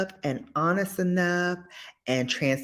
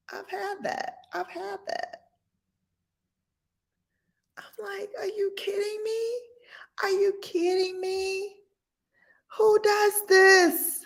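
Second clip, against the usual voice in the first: high disbelief. Retold or imitated. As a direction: squeak out stunned disbelief.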